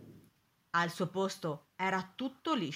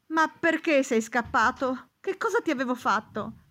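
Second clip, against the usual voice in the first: loud, higher-pitched pleading question; anguished reproach; 5 semitones higher, 9 dB louder than usual. A narrator's reading. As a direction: plead with the runaway for an explanation. Master reproaches his returned nose.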